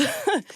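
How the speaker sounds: quick high self-deprecating laugh; short laugh. Real, not acted. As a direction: laugh it off at yourself. Laughs at own easy chords.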